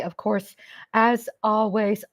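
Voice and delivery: ordinary talk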